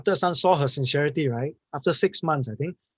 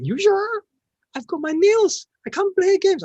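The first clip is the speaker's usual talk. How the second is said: high, loud incredulous mother voice; shocked mother; 6 dB louder, slower than usual. Retold or imitated. Mother protesting about her nails, high and loud. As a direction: squeal it out like a pampered rich mother. Rich mother balks at gaming.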